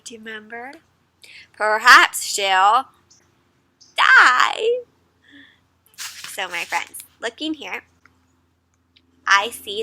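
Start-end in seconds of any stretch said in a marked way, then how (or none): 1.5-4.8 dramatic refrain. Acted. Teacher reading the book's refrain with mock drama. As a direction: draw it out, then spring the last word.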